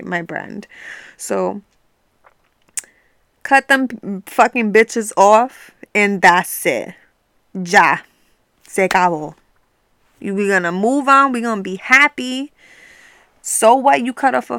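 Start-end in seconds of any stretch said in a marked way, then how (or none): 3.4-11.3 a firm, loud, slowed-down dismissal; fed-up rant. Real, not acted. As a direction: spit it out like you're done with them. Ranting about cutting off toxic people.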